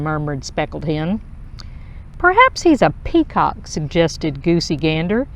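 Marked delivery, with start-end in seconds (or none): 2.2-3.7 1 gossiping voice. Acted. Gossiping goose.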